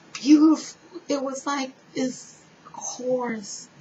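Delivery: high, loud burst of awe; awed. Real, not acted. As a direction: let the word lift in wonder. Awed description of heavenly music.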